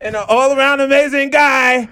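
high, loud, slow name call; drawn-out hype shout. Acted. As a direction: stretch the name out like an announcer. Hyped guest intro shout.

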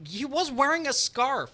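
high, slow mock disbelief; mock incredulous. Real, not acted. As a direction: echo the disbelief back. Echoing disbelief, stretched.

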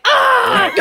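high, loud laughing shriek; mock panic yell. Friend showing how not to wake a sleeping driver, mock shriek. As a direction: show the wrong way to react. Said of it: "don't be like".

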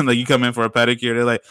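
ordinary talk, nothing marked

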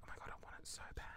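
hushed, rushed wanting; retold secret craving, under the breath; whispered longing. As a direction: whisper it quickly, like a private wish. Retold private craving for crystal.

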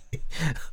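breathy mocking chuckle; a dry, voiceless little laugh; crone's sly chuckle; toneless chuckle. A narrator's reading. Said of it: "toneless chuckle".

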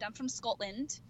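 put-on Scottish accent, in character. Shown as a demonstration. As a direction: do a Scottish lass introducing herself. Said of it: "I had to do my Scottish accent".

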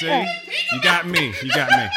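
laughing it out. Real, not acted. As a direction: say it through a laugh. Laughing at himself.

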